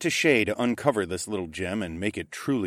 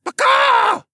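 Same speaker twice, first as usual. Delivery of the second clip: high, much louder squawk; bird squawk call; 11 dB louder than usual. A narrator's reading. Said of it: "the disagreeable beast said".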